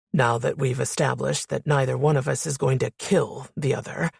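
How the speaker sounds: low man's voice leaning mockingly on "kill"; deep, mocking. A narrator's reading. Man mocking woman's threat to kill, deep voice. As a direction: drop deep and mock her threat. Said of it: "emphasized the word as if he was mocking me".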